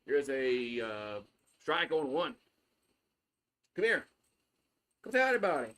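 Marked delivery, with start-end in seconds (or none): 3.8-5.7 a light sing-song coax to the dog; coaxing pet voice. Acted. To the dog, coaxing it to greet the viewers, lightly raised pet voice. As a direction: call the dog over sweetly, only a touch higher.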